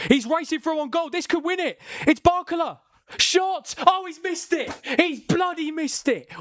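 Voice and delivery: building to a near shout, then groaning at the miss; excited. Real, not acted. A streamer calls a last-minute chance to chat, frantic then crushed.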